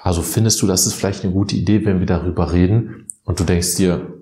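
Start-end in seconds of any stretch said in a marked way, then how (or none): none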